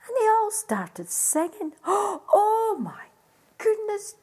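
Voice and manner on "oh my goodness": delighted gasp. A narrator's reading. Storyteller marvels at singing birds.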